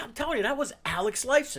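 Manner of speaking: insist, loud enough to be overheard. Retold or imitated. Friend insisting to doubting buddy, loud and high. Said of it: "And he said it loud".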